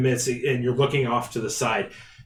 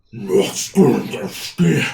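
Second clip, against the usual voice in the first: slow giant gibberish voice; lumbering monster talk in a foreign tongue; giant speaking Giant; much slower than usual. Acted. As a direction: rumble out a made-up giant tongue, slowly. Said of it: "they're just talking in their own language".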